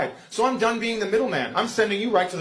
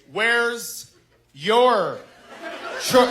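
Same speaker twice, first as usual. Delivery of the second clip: slowed down; 2 semitones higher, much slower than usual. Retold or imitated. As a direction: imitate a daughter slowly spelling out "Where's ... your ... chore", word by word. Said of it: "She slowed it down".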